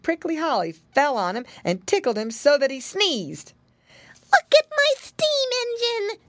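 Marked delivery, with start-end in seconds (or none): 4.3-6.2 very high piglet squeal; excited child showing off; Flop Ear. A narrator's reading. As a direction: cry out in a little boy's voice. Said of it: "cried Flop Ear".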